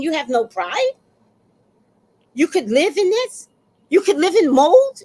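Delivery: high, rising incredulous questions; scandalized reproach. Retold or imitated. HOA woman shames tenant for living in mold, appalled.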